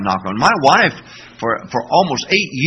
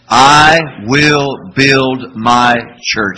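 very slow, louder call-and-response; slow group recitation; 3 semitones lower, 7 dB louder, much slower than usual. Shown as a demonstration. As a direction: drag each word out with the congregation. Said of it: "Say that with me".

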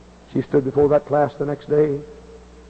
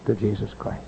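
ordinary delivery

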